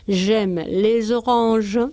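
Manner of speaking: j'aime les oranges muy despacio; slow French example. Shown as a demonstration. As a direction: dicta la frase francesa. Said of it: "Si decimos". (ejemplo francés muy lento)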